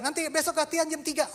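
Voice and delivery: high fast rush; captain sets practice time, high and fast; quick captain. Retold or imitated. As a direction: rattle it off brightly. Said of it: "Terus dia".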